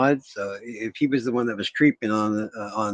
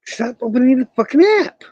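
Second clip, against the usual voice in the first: real angry scream, much higher and louder; furious scream; 13 dB louder than usual. Real, not acted. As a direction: scream it at the laptop, at the end of your rope. Screaming at the laptop.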